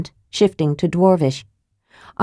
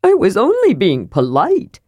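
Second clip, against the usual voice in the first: aggrieved; 2 semitones higher than usual. Acted.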